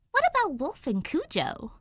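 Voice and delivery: high, defensive protest; defensive objection. Acted. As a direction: push back, defending her friends. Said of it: "Danny objects defensively".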